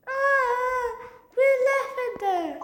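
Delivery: high-pitched acted crying; tearful wail. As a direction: wail it through sobs like an upset child. Child wails over lost iPad.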